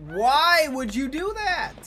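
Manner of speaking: startled outcry. Real, not acted. Startled by the killer.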